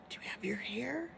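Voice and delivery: whispered question. Real, not acted. Whispered aside to class.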